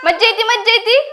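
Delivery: high, sing-song cheer; cheery prompting. Acted. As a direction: chant it brightly for the child to copy. Prompting child to say it's fun.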